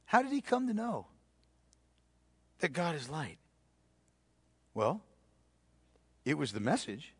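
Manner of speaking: soft, confiding sermon voice; hushed intimate preaching. Real, not acted. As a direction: lean in and speak softly to the flock.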